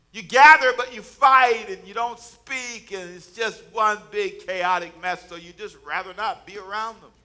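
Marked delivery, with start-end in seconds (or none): 0.1-4.0 much higher and much louder, crying it out; preaching shout. Real, not acted. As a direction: shout the point to the back pew. Preaching at full force.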